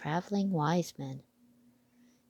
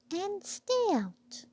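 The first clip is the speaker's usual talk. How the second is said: very high, slow and firm; slow piping order; bossy mouse squeak; 13 semitones higher, slower than usual. Acted. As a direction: draw it out like a stern tiny mouse.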